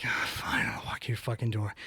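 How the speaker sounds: whispered, put-upon grumble; resentful aside, barely voiced; grudging whisper. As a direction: hiss it under your breath, annoyed. Grudging muttered inner reply.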